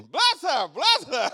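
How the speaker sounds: high, loud bless her; shouted blessing. Acted. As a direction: call it out over the room. Preacher reprises shouted blessing.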